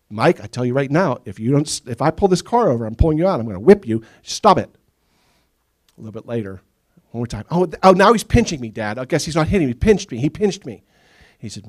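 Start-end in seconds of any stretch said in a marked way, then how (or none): 0.1-4.7 loud stern warning; mean every word; angry dad threat. Retold or imitated. 7.5-10.8 put-on high, loud whining kid; whining tattletale.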